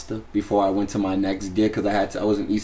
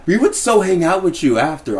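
higher-pitched gushing mimicry; excited women guests; 7 semitones higher, 4 dB louder than usual. Women guests offering to hang out after, excited mimicry. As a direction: imitate an eager fan, pitched up. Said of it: "they're like".

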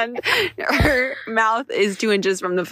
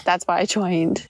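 ordinary talk

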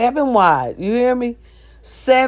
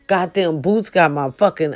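usual speaking voice

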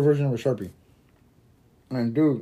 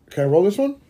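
friend asking; 5 semitones higher, 4 dB louder than usual. Retold or imitated. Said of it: "her homegirl goes like".

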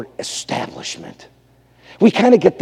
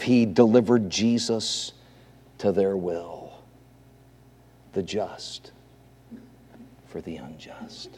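low voice fading to almost nothing; grave, very soft refrain; solemn hushed preaching; 7 semitones lower, 11 dB quieter than usual. Real, not acted. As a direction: leave long pauses and let each phrase sink.

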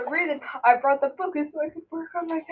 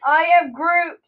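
much louder, stubborn catchphrase; insistent Groot; 8 dB louder than usual. Pretend. Answering a friend who says grunt, loud and stubborn. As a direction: push the Groot line out hard.

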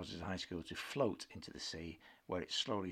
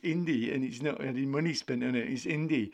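Irish mimicry of a friend, higher and louder; mocking imitation; 5 semitones higher, 7 dB louder than usual. Friend mocked for scorning indie films, a higher louder mocking voice. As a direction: mimic her dismissive take on indie films, hammed up. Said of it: "and you're like".

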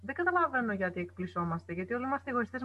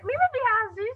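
much higher, teasing little squeal of protest; playful mock protest; 11 semitones higher, 4 dB louder, slower than usual. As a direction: squeal it jokingly at someone hugging you. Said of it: "να λέμε". (παιχνιδιάρικη ψεύτικη διαμαρτυρία, πολύ ψιλή φωνή)

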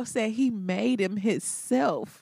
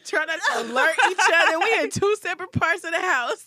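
laughing protest; 8 semitones higher, 4 dB louder than usual. Real, not acted. Defending herself, laughing.

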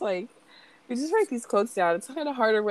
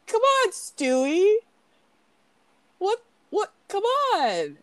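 high, drawn-out mock whine; hammed-up high-pitched protest; helpless pleading dad; 9 semitones higher, slower than usual. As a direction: plead with a kid who won't quit. Acting pestered Peter pleading with Stewie.

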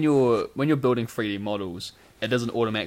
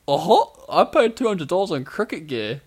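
lightly raised mocking voice; Jack impersonation; 4 semitones higher, 5 dB louder than usual. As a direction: mimic your co-host, pitched a little up. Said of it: "my best Jack impersonation".

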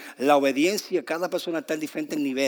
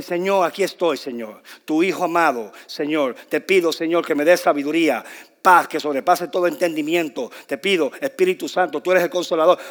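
loud, declaimed prayer; grand prayer; 7 dB louder than usual. Acted. Preacher imitating a wordy prayer to God, loud and declamatory. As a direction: proclaim it like an elaborate public prayer. Acts out an elaborate formal prayer.

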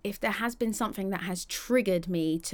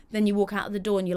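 normal talking voice

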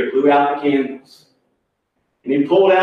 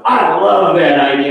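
delighted approval; 2 semitones higher, 6 dB louder than usual. Retold or imitated. Harry takes to the party idea, imitated loud delight. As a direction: imitate a man thrilled by a plan.

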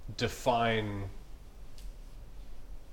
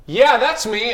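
bossy challenge; 6 semitones higher, 5 dB louder, slower than usual. Retold or imitated. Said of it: "switched into that bossy little motherfucker".

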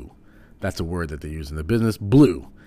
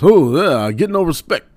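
much higher and louder, a strained self-pitying complaint; Rodney Dangerfield impression; 11 semitones higher, 12 dB louder than usual. Comedian imitates an old stand-up's catchphrase, high and strained. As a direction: belt out the famous no-respect line in a pinched voice. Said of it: "Rodney Dangerfield was".

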